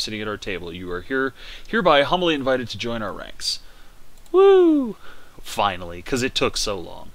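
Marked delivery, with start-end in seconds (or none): 4.3-5.0 high loud whoop of joy; cheer. Real, not acted. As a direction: whoop it out in triumph. Finally invited to join.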